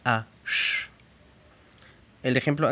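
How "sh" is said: S pronounced as "sh"; a drawn-out hushing "sh"; sonido sh. Shown as a demonstration. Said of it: "la S cambia su pronunciación". (S pronunciada como "sh")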